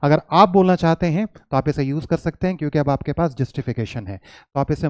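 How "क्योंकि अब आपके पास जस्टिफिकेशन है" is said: normal talking voice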